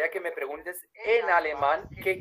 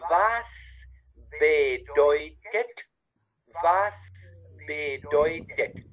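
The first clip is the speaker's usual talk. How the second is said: very slow, each syllable drawn out; slow, careful teacher model; said slowly; much slower than usual. Shown as a demonstration. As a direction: spell it out slowly for a learner. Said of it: "dilo despacito".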